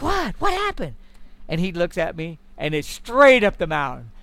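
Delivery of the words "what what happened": high, startled questions; baffled what. Retold or imitated. Hunter asking his partner what happened, high and startled. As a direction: pitch it up in disbelief.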